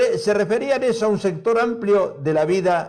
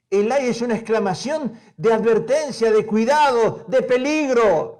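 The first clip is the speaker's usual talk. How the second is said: raised, forceful Bible reading; preaching scripture; 5 semitones higher than usual. Acted. Preacher reading Jesus' woes to the church, forceful. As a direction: proclaim each woe like a warning.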